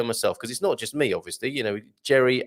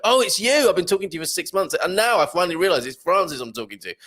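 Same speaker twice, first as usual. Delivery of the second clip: sudden recognition; 5 semitones higher, faster than usual. Acted. Forum member finally realizing who they've been talking to, surprised recognition. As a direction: act out a belated penny-drop moment. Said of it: "and then go".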